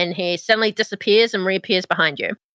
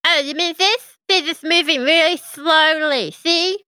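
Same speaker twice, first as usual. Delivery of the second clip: higher, matter-of-fact imp; brisk little servant imp; 6 semitones higher, slower than usual. Acted. GM voices imp.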